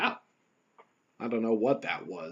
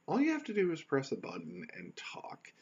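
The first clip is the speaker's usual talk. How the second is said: whispered, confiding; 4 semitones higher, 6 dB quieter than usual. Real, not acted. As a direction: lean in and whisper it like a secret. Intimate theater of the mind aside.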